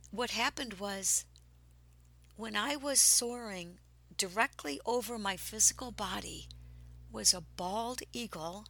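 normal talking voice

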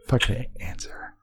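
hushed whisper while the phone rings. Real, not acted. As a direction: whisper it so the caller can't hear. Waiting for the call to connect.

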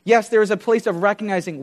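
usual speaking voice

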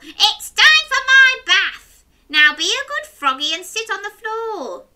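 high, loud cackling witch. Acted. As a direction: screech it like a wicked witch. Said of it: "heard the witch cackle".

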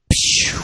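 loud airy "pew" gun noise; imitated gunshot. Kid firing pretend gun in class, imitated gunshot. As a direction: mouth a sharp gunshot sound.

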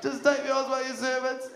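high tearful plea with a half-laugh; hammed-up blubbering apology to dad; crying son. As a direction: beg through mock tears, voice pushed up high. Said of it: "the son starts crying".

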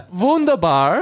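high, drawn-out German exclamation; hammed-up German accent. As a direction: sing it out high in mock German. Continues the German accent game.

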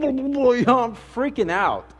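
high, panicked, half-laughing retelling; freaking out. Retold or imitated.

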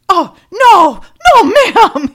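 high, loud, earnest "oh no, ma'am"; an aw-shucks guard protesting sweetly; a guard politely denying he listens, wholesome and sweet; wholesome security guy. Retold or imitated. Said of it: "so, so wholesome and sweet about it".

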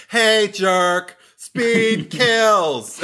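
high, mocking taunt; podcast host voicing Annie's taunt. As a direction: sneer it out in a high voice. Mock legacy character quote.

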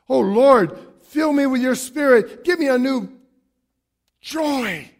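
preaching. Acted. Pastor urges the church to pray for joy, fervently. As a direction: preach it with full conviction.

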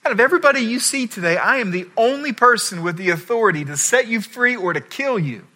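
a governor losing patience, rushing his threat; uptight Pilate. Retold or imitated. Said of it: "Pilate gets all uptight and he goes".

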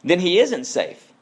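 lightly voiced little girl, wide-eyed; Lucy. Lucy realizing the lion is dangerous, lightly voiced. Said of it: "said Lucy".

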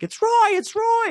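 very high, loud fan alert; imitated frantic fan announcing a sighting; starstruck friend shouting. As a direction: shriek it like you've spotted an idol. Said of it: "Chris was like".

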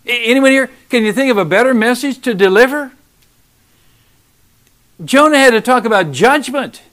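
loud, impassioned preacher pressing the point; fiery sermon warning of hell; preaching at full force. Acted. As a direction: drive it home to the congregation, loud. Preaching, challenging congregation.

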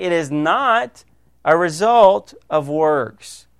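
slow, stressing not; slow, firm stress on not; very clearly. Shown as a demonstration. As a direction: spell it out, slowly and firmly. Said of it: "he says very clearly".

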